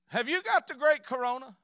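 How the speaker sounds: put-on loud, high-pitched question to an elderly mother; high and loud, spelling it out; calling out loudly. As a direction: raise your voice so Mama hears. Son calls out to elderly mother.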